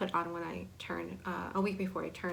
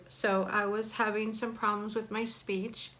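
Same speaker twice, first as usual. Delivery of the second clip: ordinary delivery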